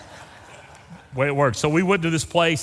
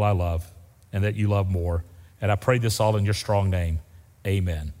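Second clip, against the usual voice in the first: pastoral prayer; 5 semitones lower than usual. Real, not acted. Pastor leads a closing prayer.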